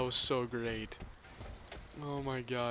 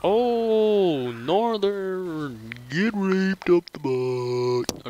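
taunting yell; 5 semitones higher, 11 dB louder, much slower than usual. Real, not acted.

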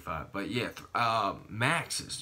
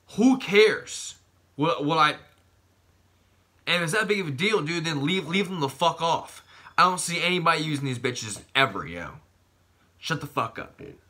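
angry rant; 6 semitones higher, 7 dB louder than usual. Real, not acted. Ranting about gym owner.

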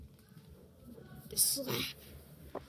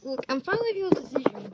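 ordinary talk

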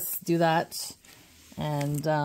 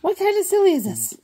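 high play voice; 10 semitones higher, 4 dB louder, slower than usual. Pretend. Figure complains about broken ship.

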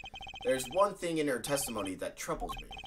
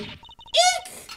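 high squeak; 9 dB louder than usual. Acted. Startled witness squeals.

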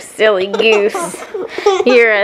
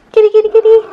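calling the cat; 11 semitones higher, much faster than usual. Real, not acted.

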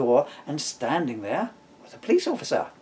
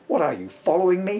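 higher, irritated outburst at the rake; annoyed, higher exclamation; annoyed exclaim; 6 semitones higher, 4 dB louder than usual. Acted. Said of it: "he exclaimed".

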